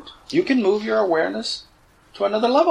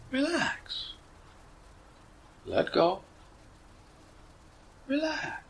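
hushed, spaced-out relax cues after a measured instruction; guided meditation; 3 dB quieter than usual. Acted. Teacher telling listeners to relax and let go, soft meditation voice. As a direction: soothe them into letting go, with long pauses.

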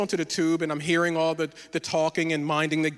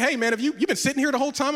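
higher, mock-surprised question with rising end; 7 semitones higher, faster than usual. Friend finds him on the bench, surprised question. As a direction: ask it as a puzzled friend, voice lifting.

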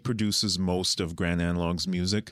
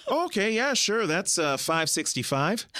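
store clerk; 11 semitones higher than usual. Retold or imitated. Store clerk voiced in story.

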